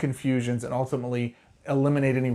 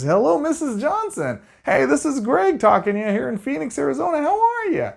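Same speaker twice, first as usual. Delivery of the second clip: high salesy phone voice; 14 semitones higher, 4 dB louder than usual. Shown as a demonstration. Rookie salesman greeting Missus Johnson on the phone, very high pitched. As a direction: chirp the greeting high like an eager telemarketer. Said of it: "My tone always goes really, really high".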